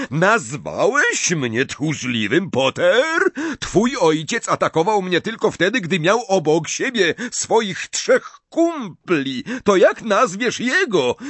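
high, emphatic challenge; indignant retort. A narrator's reading. Snape stung by being called coward, indignant. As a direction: fling it back, pitch riding high. Snape answers the insult mid-duel.

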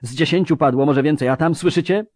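high, loud, terror-stricken report; panicked croaking. Acted. Frightened mercenary reporting demons and slaughter, panicked croaking. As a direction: gabble out the horror at a high pitch. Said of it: "wycharczał jeden".

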